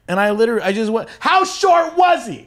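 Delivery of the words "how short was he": high, loud, drawn-out shout; exaggerated indignant yell; retold heckle. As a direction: holler it slowly like a heckler. Acted heckle at comic on stage.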